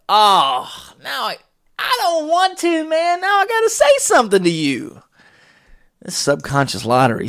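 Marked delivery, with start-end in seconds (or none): 0.1-5.0 high whining voice, pretending to dread it; mock whiny reluctance. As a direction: whine like you really don't want to say it. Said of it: "And I was like".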